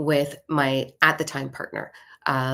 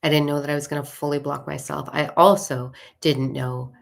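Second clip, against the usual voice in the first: ordinary talk, nothing marked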